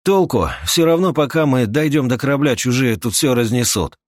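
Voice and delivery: exasperated, high and loud objection; спорящий старатель. Acted. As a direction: argue back hotly. (раздражённое возражение на повышенном тоне)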